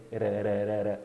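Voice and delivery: ra repeated as quick tongue taps; tapped R drill. Shown as a demonstration. Teacher isolates the flap in kidding, repeated tapped ra. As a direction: chain ra with a flicked tongue. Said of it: "como o som do R brasileiro".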